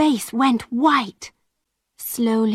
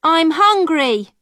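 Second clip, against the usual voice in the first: put-on hungry girl; 6 semitones higher than usual. A narrator's reading. Alison begging the peddler.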